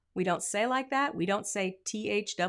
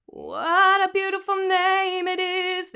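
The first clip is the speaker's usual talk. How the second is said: slow sung line, creak on "What"; big creak; 10 semitones higher, 8 dB louder, slower than usual. Shown as a demonstration. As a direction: sing it with an exaggerated creak on "What". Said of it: "a super big creak to make a big example".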